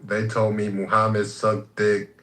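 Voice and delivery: ordinary delivery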